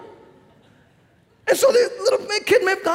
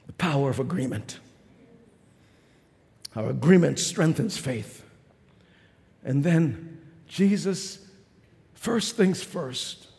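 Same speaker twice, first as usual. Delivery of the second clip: much lower and softer, very slow intimate preaching; hushed sermon; 14 semitones lower, 7 dB quieter, slower than usual. Acted. Preacher to congregation, slow hushed intimate preaching. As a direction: draw them in with a near-whisper sermon.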